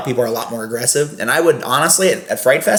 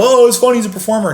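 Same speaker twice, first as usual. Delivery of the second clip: put-on high, sneering parody of the drunk guest; mock their excuse, raised and louder; a snide fool brushing it off; mocking dumb guest voice; 10 semitones higher, 4 dB louder than usual.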